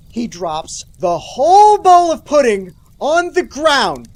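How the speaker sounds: loud, drawn-out cry of mock anguish; hammed-up horrified shout; mock horror. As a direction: stretch it out high and loud, overdramatic. Mock horror at dropped pudding.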